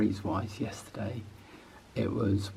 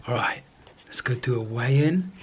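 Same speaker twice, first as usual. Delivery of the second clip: usual speaking voice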